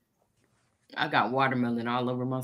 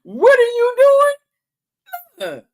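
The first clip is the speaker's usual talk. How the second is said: high squeal, much louder; high squeaky girlfriend voice; 12 dB louder than usual. Retold or imitated. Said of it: "She looking at me like".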